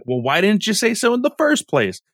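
high-pitched retold retort; mock exasperation. Bulma retorts to Oolong about the clothes, mock exasperation. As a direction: imitate an indignant why-didn't-you. Acted retort after being told late.

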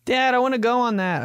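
put-on a kid tugging at dad's sleeve, high and pleading; kid whining. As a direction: beg like a small child for the ride. Kid begging dad at fair.

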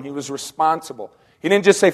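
usual speaking voice

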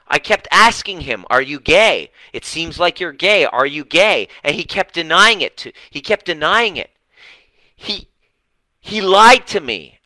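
high, loud, aggrieved wife; shrill-free, insistent complaint pitched high; mimicking Tina. Client tells coach of husband's lies, high and loud aggrieved. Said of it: "And she said".

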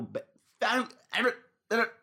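high garbled syllables as the words fail; words collapsing into nonsense; tongue-tied mumble. Real, not acted. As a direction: stumble over the name into high-pitched mumbling. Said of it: "I'm about to start mumble rapping".

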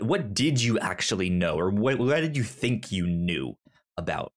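ordinary delivery

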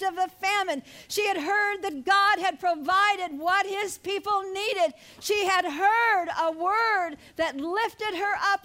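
preaching. Real, not acted. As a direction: drive each point home to the congregation. Preaches at raised force.

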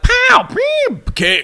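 high shouted comic-book sound effects; campy pow and pew shouted high; cartoon fight sounds. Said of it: "without going like".

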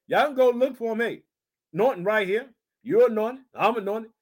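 normal talking voice

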